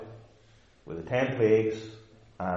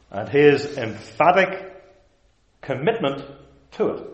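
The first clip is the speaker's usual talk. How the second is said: ordinary delivery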